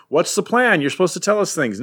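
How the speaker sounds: high, fast whiny complaint; mock whine, pitched high; whiny henchman. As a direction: needle the boss like a petulant underling. Henchmen whining at their leader.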